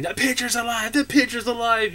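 high panicked mock scream; a kid fleeing the house in fright; frightened child screaming; retold scream. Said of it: "I ran out of the house screaming".

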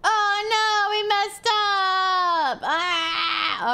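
high, slow, wailing oh no; panicked wail. Real, not acted. Panics at a mistake.

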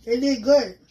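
eating while speaking; talking while chewing. Real, not acted. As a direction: mouth partly full. Seen 0: Left woman bites food just before, talks with jaw moving.

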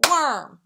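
"Worm" as one loud, punched syllable; "Worm" hit hard in one beat; single clapped beat. Shown as a demonstration. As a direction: clap once as you say "Worm". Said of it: "That's just one".

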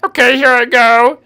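ordinary talk

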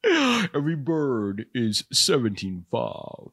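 very slow, put-on suspense-host voice; drawn-out, droll impression. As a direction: quote a price with mock-sinister relish.

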